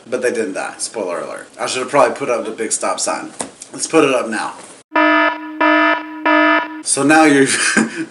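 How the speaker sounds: usual speaking voice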